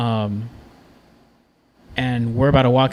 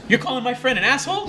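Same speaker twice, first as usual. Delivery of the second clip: retold challenge, pitched way up; worked-up retort; 11 semitones higher, faster than usual. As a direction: act out a buddy getting all worked up. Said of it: "starts getting all excited".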